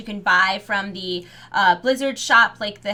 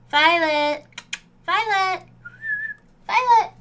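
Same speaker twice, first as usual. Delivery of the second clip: calling a name, each call higher; calling the dog; 9 semitones higher, slower than usual. Real, not acted. Calling the dog from another room.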